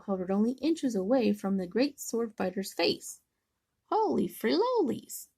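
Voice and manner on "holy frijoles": high startled exclamation; exclaiming. Acted. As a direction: cry out in alarm. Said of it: "cried Scapito".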